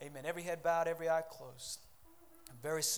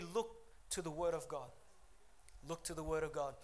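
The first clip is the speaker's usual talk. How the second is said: normal talking voice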